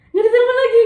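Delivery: frustrated friend-chat retelling; exasperated outburst. Real, not acted. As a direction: blurt the frustration. Waiting all night for call.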